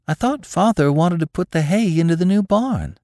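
high, puzzled daughter's voice; high-pitched girlish puzzlement; wonderingly. A narrator's reading. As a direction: question it in innocent wonder. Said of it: "she said wonderingly".

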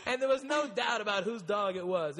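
normal talking voice

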